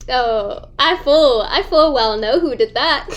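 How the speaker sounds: ordinary talk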